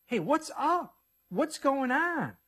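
high, slow, puzzled beggar calling out; curious blind man. Acted. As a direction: ask the crowd what is happening, drawn out. Blind man asks about the noise.

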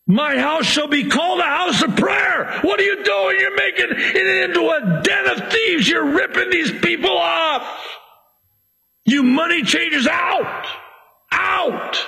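high, angry shout at the money changers; Jesus shouting. Acted. Jesus shouting at the money changers in the temple, furious. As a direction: drive them out with a furious yell.

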